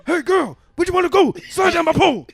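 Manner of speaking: loud, high wrestler voice; wrestling promo. Retold or imitated. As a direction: cut a wrestling promo, yelling. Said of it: "Like I'm Robert Frank".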